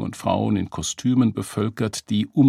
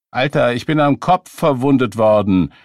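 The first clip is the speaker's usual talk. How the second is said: loud, higher-pitched indignation; indignant protest; 5 semitones higher, 8 dB louder than usual. A narrator's reading. Beggar defends his story to his questioner, indignant. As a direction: push back loudly, affronted.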